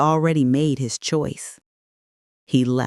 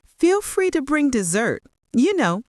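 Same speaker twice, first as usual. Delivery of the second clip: high, airy offhand tone; breezy cheer; 9 semitones higher than usual. A narrator's reading. Daughter-in-law suggests bringing dessert, breezily cheerful. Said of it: "Olivia's cheerful voice".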